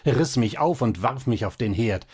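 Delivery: ordinary delivery, nothing marked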